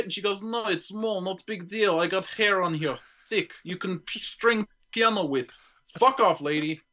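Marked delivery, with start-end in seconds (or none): 0.4-5.6 broken English in a put-on Russian accent. Russian woman brushing off her hairy mole, mock Russian accent. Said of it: "here's my problem with Russians".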